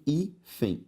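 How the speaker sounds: "hífen" slowly split as hí, fen; syllable by syllable. Shown as a demonstration. Teacher separating the word for students, syllable by syllable. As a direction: pause inside "hífen". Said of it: "se eu separo hífen".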